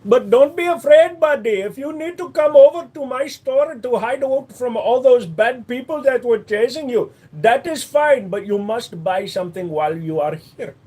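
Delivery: high, loud put-on Pakistani accent; attempted Pakistani accent. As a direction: play a shopkeeper with a mock Pakistani accent. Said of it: "I think that was Pakistani".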